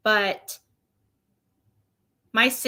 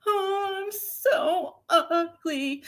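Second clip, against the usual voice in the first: high, slow mock whine of the little sister; mock whiny sister; 8 semitones higher, much slower than usual. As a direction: play the sister whining that she is ugly, high and slow. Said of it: "she's cried to me about".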